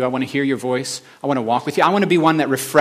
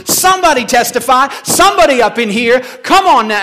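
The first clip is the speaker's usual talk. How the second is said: much higher and louder fiery preaching; preaching at full force; 13 semitones higher, 8 dB louder than usual. Retold or imitated. Woman preacher urging the congregation, loud and fiery. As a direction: drive the crowd to testify. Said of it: "And she says".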